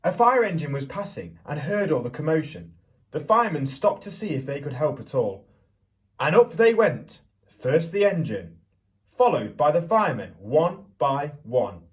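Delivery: put-on lively storyteller voice for children; picture book read-aloud. A narrator's reading. Teacher reading Stuck to the class, storytelling voice.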